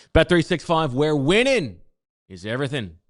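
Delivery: "Bet three six five, where winning" hit loud and high; punchy Irish-accent slogan. Acted. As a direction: sell the slogan big in a mock Irish accent. Slogan tag in ad read.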